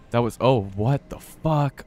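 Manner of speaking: real awe after a scare; stunned exclamation. Real, not acted. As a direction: let the disbelief stretch slowly. Amazed after monster encounter.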